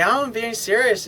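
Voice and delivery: a hint of a girlfriend insisting she means it; protesting girlfriend. Girlfriend protests, voiced by man.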